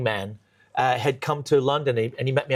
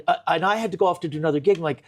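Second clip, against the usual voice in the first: ordinary talk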